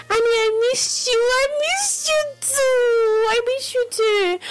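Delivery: high, slow mock sob reading the text; mock crying. Radio host reading a fan's text, mock tearful. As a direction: wail it out as if teary.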